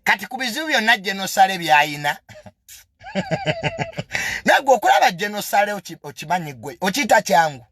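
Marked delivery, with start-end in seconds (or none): 3.1-4.4 squeaky burst of laughter; a sudden shrill-free cackle pitched way up; high scornful giggle; high mocking laugh. Real, not acted.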